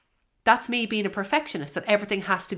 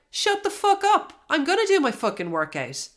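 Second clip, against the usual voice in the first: high, forceful retort to yourself; firm telling-off; 10 semitones higher than usual. Shown as a demonstration. To her own negative inner critic, a high forceful retort. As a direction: talk back hard to the voice in your head. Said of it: "just say out loud".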